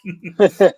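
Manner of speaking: high burst of laughter; loud laugh. Real, not acted. Laughs after the quote.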